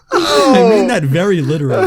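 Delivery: loud, high-pitched through laughter; real laughter squeezing the words high; laughing protest. Real, not acted. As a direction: insist while cracking up. Laughing while defending his remark.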